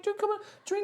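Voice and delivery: high, gentle coaxing voice of a small wooden woman; wood spirit. Acted. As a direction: soothe a crying stranger in a high, motherly voice. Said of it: "She's like".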